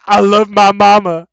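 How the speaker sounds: a movie character's dopey declaration of love; imitating Waterboy. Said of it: "he's like".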